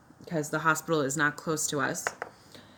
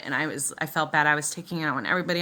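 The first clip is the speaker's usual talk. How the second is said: usual speaking voice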